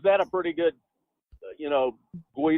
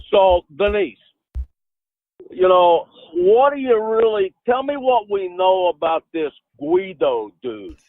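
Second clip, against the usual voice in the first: put-on loud New Jersey Italian accent; Jersey wise guy, loud; 4 dB louder than usual. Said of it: "in my best New Jersey Italian accent".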